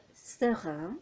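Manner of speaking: ordinary talk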